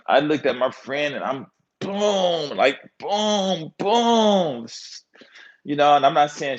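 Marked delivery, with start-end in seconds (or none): 1.8-4.6 booms climbing higher each time; long, high mimed warning noise; drawn-out booms. Retold or imitated. Nudging his friend without saying shut up, high drawn-out booms.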